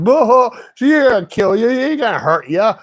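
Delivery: high, mocking taunt; Jay's dad mocking. Laughing dad to scared drivers, mocking. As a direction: tease with a put-on boo-hoo. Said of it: "when Jay's dad was laughing".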